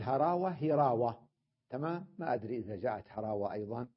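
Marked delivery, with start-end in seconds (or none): 0.0-0.5 هراوة said as herawa, with an e after the h; local way of saying it. Shown as a demonstration. Teacher showing students the local herawa. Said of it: "نحن ما نقول". 0.5-1.3 هراوة said as hirawa, with a clear kasra after the h; correct classical form. Shown as a demonstration. Teacher giving students the correct hirawa. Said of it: "نحن ما نقول".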